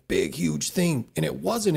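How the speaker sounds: usual speaking voice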